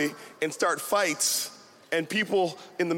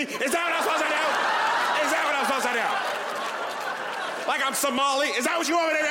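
outraged yoga mom; 9 semitones higher than usual. Retold or imitated. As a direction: play the furious yoga mom who lost her farmers market.

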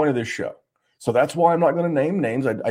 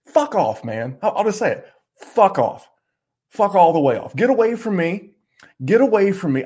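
fired-up angry; 6 semitones higher than usual. Real, not acted. Host telling bad advisers to get lost, angry. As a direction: tell them to get lost, really mad. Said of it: "I'm mad".

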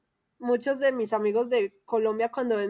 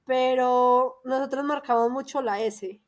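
usual speaking voice

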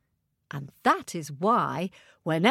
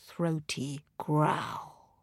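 plain reading voice

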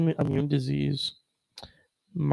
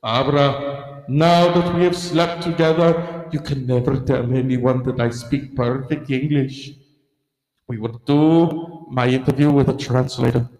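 put-on Castro voice with thick accent; 3 dB louder than usual. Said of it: "'cause Castro was like".

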